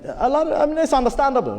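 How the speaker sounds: ordinary talk, nothing marked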